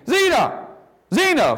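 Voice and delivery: calling the name out high and loud; loud cry. Acted. Jesus calling the dead child by name, high ringing call. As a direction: cry out to wake the dead boy. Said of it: "cried out in a loud voice".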